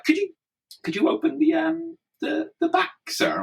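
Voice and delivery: high, halting polite request; wary policeman. Retold or imitated. Retold wary policeman asks to open boot.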